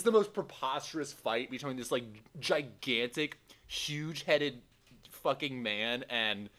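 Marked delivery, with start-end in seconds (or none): none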